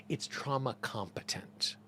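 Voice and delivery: a soft whisper, slowed for emphasis. Real, not acted. Teacher whispers the key term to her viewers. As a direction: lean in and whisper the key point.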